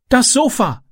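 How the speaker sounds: high-pitched cry of alarm; ausrufen. A narrator's reading. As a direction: call it out high, at normal volume. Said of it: "rief er". (hoch gerufen)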